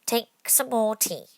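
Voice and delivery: slow, earnest offer; very earnestly. A narrator's reading. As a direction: press the tea on her earnestly. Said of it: "very earnestly".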